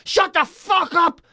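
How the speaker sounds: high-pitched, drawn-out exasperated yell; retold shout. Retelling sleepless nights to co-host, mock furious shout. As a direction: act out yelling at the seagulls. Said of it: "I'm shouting at Seagull".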